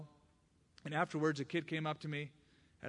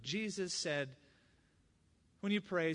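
usual speaking voice

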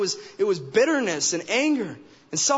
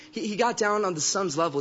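ordinary talk, nothing marked

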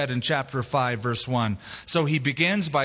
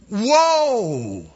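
louder, higher, long falling yell; panicked shout; 5 semitones higher, 5 dB louder than usual. Acted. Rider trying to stop his horse, panicked drawn-out shout. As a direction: holler desperately at a runaway horse. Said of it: "So he shouted out".

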